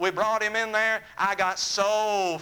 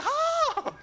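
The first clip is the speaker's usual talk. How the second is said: high yelp of shock from cold water; a sudden high yelp as cold water hits. Retold or imitated. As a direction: squeal like icy water hit him. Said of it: "he went".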